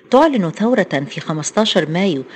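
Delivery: ordinary delivery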